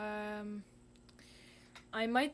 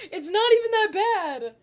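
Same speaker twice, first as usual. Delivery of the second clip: high, loud relieved squeal; 12 semitones higher, 20 dB louder than usual. Real, not acted. Relief after flipping canvas.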